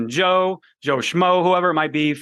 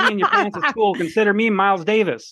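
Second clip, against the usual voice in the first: high-pitched movie-line delivery; Billy Madison quote; 5 semitones higher than usual. Retold or imitated. Co-host quoting Billy Madison to the other, high-pitched imitation. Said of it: "Billy Madison".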